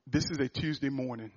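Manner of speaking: low, soft and subdued; hushed, sombre retelling; retelling a crying man. Retold or imitated. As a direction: quote the grieving man gently, no sobs. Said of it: "And he's crying".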